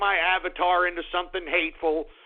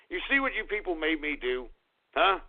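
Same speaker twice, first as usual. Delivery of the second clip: ordinary delivery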